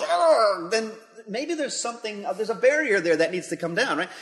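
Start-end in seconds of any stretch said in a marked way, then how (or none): 0.0-0.7 loud, high indignant "argh"; preacher voicing righteous anger at injustice with a loud growl; frustrated growl. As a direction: let out a sharp growl of outrage. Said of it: "rises up and goes".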